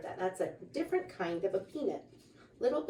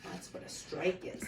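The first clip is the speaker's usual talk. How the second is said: quick hushed whisper; 9 dB quieter than usual. Real, not acted. Parent explaining strike to child in a fast whisper. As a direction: explain it under your breath.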